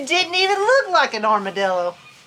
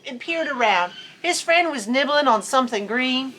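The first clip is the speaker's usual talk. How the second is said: ordinary delivery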